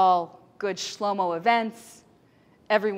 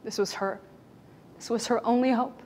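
ordinary delivery

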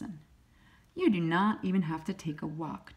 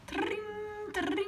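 high bright bell ring; bell imitation; 4 dB quieter than usual. A narrator's reading. Reading a picture book, voicing the bicycle bell. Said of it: "Bicycle bell ringing".

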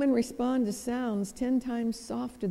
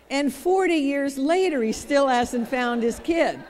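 punchline; 4 semitones higher, 6 dB louder than usual. Real, not acted. Punchline of research joke.

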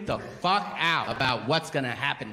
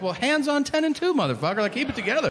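mock instruction; 9 semitones higher, faster than usual. Mocking the stoned driver.

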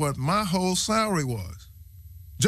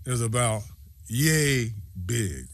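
ordinary talk, nothing marked

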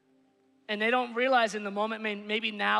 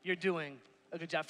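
soft, low and sincere; tender reassurance, almost hushed; heartfelt encouragement; 5 semitones lower, 6 dB quieter than usual. Real, not acted. Pastor sincerely encouraging fathers from the heart, softly. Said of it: "from the bottom of my heart".

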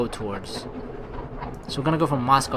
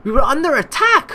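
higher and louder alarmed narration; dramatic alarm; 10 semitones higher, 6 dB louder than usual. Acted. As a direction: sound the alarm as you read.

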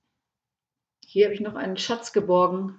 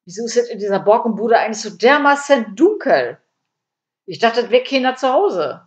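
louder, higher, exasperated grumbling; annoyed complaint; 4 semitones higher, 6 dB louder than usual. A narrator's reading. Burglar complains about the dark house, exasperated. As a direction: demand an explanation, fed up.

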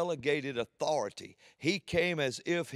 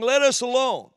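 sudden high shout; demon crying out; 10 semitones higher, 10 dB louder than usual. Acted. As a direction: cry out like a tormented demon. Preacher voices demon crying out.

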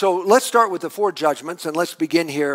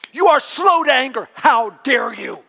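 shouted, higher, louder angry prayer; furious prayer; 6 semitones higher, 4 dB louder than usual. Acted. Jonah raging at God for his mercy, shouting. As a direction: spit the praise out like an accusation. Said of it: "that's how Jonah's praying".

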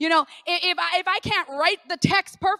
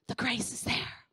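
whispered; 3 semitones lower, 9 dB quieter than usual. Shown as a demonstration.

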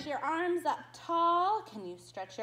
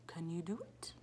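whisper; 8 semitones lower, 8 dB quieter, faster than usual. Acted. Whispered prompt.

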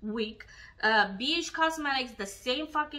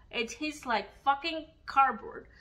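usual speaking voice

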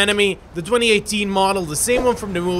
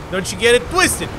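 ordinary talk, nothing marked

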